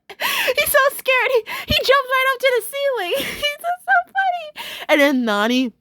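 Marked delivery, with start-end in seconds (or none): 0.1-4.7 high squeal through real laughter; helpless laughter. Real, not acted. Fan retelling Mister Grouper's jump to the ceiling, helpless laughter. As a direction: barely get the words out for laughing. Cracking up at the vampire scare.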